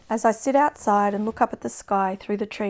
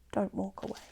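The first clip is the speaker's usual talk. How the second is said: the repeat falls much softer, almost gone; fading plea; 10 dB quieter than usual. Acted. Begging a lover not to leave, fading to a hush. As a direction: let the last plea die away to a hush.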